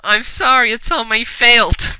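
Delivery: hammed-up remorse; cried. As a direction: plead with a touch of fake crying. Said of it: "Marissa cried".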